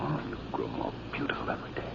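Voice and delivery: hushed, breathy murmur of love; tender whisper. Acted. Suitor whispering to Mariana, tender and hushed. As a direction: whisper it close to her ear. Lovers alone, tender moment.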